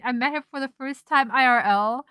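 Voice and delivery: normal talking voice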